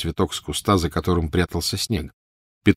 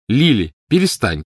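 put-on higher-pitched child's cry to stop; calling out sharply; 5 semitones higher, 4 dB louder than usual. A narrator's reading. Older sister ordering Lily to stop, crying out. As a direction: cry out as a scolding elder sister. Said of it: "крикнула старшая".

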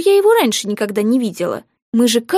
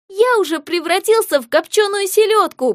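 whining; 8 semitones higher than usual. Acted. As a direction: whine it like a bored kid. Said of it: "проныл Савка". (ноющим голосом)

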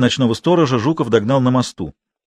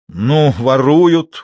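very slow, louder drawl; wry scoff; 3 semitones higher, 5 dB louder, much slower than usual. A narrator's reading. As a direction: stretch it out with a smirk. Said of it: "усмехнулся Матвей".

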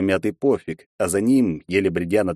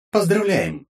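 game system announcement; 12 semitones higher, slower than usual. Acted. Системное сообщение игры.

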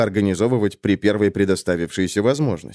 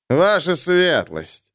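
slow, slurred and grumpy; grumpy drunk; 6 semitones higher, much slower than usual. A narrator's reading. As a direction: mutter it like a sullen drunk. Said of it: "сварливым и пьяным голосом". (сварливый пьяный голос)

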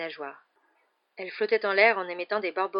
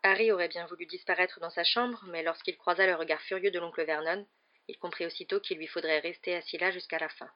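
ordinary delivery

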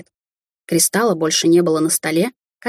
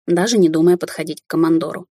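usual speaking voice

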